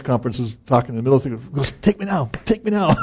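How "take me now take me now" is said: high, urgent appeal; playful mock pleading, pitched way up; imitated eager plea. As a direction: call upward as if begging to go. Imitating speaker interrupting his own talk.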